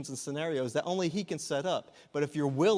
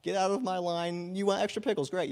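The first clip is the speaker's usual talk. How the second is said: customer-service patter; 6 semitones higher than usual. Acted. Restaurant worker to a customer, rote customer-service patter. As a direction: put on a high customer greeting voice. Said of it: "it's customer service".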